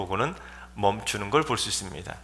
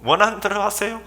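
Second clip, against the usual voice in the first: very high, light agreement; easy assent; 8 semitones higher than usual. Acted. Listener suddenly giving in to a complainant, light high assent. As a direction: concede breezily in a high voice. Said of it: "갑자기 그 사람이 말 듣고".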